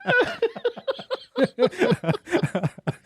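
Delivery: real burst of high-pitched laughter. Real, not acted. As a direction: crack up high and long. Cracking up at joke.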